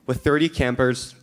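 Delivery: normal talking voice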